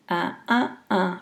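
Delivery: "uh, uh, uh" as separate short beats; syllable rhythm demo. Shown as a demonstration. Lecturer mimicking wave bye-bye's rhythm, "uh" in separate beats. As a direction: tap out the rhythm on "uh". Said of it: "you can hear syllable number".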